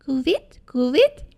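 high hooting owl imitation; two rising owl calls; owl cry. A narrator's reading. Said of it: "den Schrei einer Eule".

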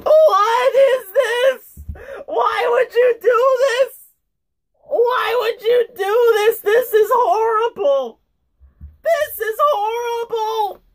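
high, wailing disbelief; anguished protest. Real, not acted. Reader protesting at a horrible story, anguished wail.

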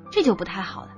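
plain reading voice

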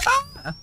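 sudden high shriek of fright; terrified scream. Real, not acted. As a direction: scream out in terror. Scream in horror game.

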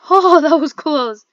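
relieved laugh. Real, not acted. Laughing after near catch.

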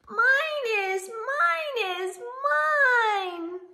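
high, loud sing-song chant of mine; high singsong little-one reply; eager little voices. Acted. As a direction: chant it like an excited toddler claiming. Eager answer to story question.

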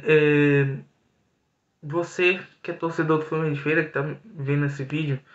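ordinary delivery

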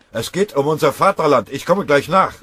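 very loud, high patriotic yell; rally him with a shout. Acted. Kriegsrat schreit weiter.

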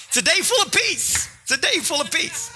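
high, sing-out morning cheer; hammed-up chirpy good mood; mock cheerful. Spouse greeting the sleeper with peace, comically chirpy.

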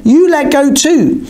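high, drawn-out counter-accusation; slow it down and pitch it high, accusing; indignant blame. A narrator's reading. Narrator blames Norva in return, high drawn-out indignation.

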